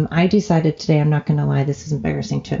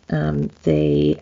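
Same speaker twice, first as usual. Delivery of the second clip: usual speaking voice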